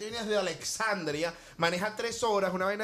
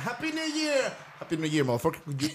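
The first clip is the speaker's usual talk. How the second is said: imagined neighbor. Retold or imitated. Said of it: "me imagino a Leo abriendo la puerta".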